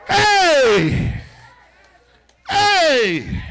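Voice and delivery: loud, chanted, slow preaching shouts; preaching at full force. Acted. Preacher declaring victory to the congregation, full-force preaching. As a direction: drive the congregation into a roar.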